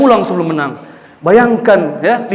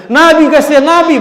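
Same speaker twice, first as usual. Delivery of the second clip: urgent plea; 9 semitones higher, 4 dB louder than usual. Acted. Wife pleading urgently.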